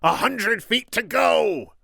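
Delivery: high, loud, stretched-out scream. A narrator's reading. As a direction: scream to urge the climber upward. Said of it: "the Sicilian screamed".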